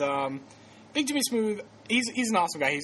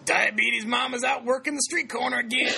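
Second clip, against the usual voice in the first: loud, higher-pitched coworker impression; Jim; 6 semitones higher, 4 dB louder than usual. Host mimicking coworker back from coffee run, hammed-up impression. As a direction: imitate a coworker announcing gossip, high and raised. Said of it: "That's how he talks, by the way. I do the best Jim impression".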